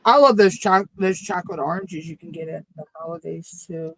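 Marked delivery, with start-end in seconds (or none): none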